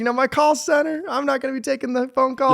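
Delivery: high-pitched send-up of an earlier self; mock self-imitation. Owner recalling early call center plans, self-mockingly.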